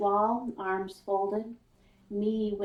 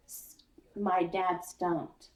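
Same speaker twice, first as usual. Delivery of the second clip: ordinary delivery, nothing marked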